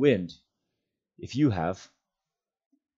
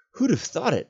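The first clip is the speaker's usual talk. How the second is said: higher, pleased surprised exclamation; exclaiming; 12 semitones higher than usual. Acted. Frodo marveling at Sam's long rope, surprised. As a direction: marvel at the rope's length. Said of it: "Frodo exclaimed".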